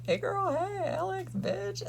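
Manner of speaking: shrill-pitched mock greeting to a friend; high squeal greeting. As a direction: squeal it like a giddy fan.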